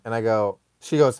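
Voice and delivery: normal talking voice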